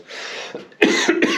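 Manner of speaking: a longer rough cough between sentences; real cough. Real, not acted. Vlogger filming the studio setup, real coughing. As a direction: cough it out, then carry on. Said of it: "I have got a bit of a cough".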